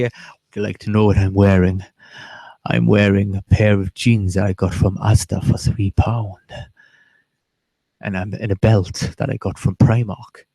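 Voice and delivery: low, slowed put-on seductive voice; mock sexy phone voice. Joking to her friend about a paid chat line, low and mock-seductive. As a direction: purr it low, hamming up a sultry line. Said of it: "I could speak to you in a sexy way".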